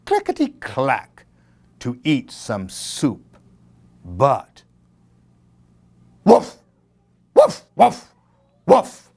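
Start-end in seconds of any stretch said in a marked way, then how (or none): 6.2-9.1 barking dog. A narrator's reading.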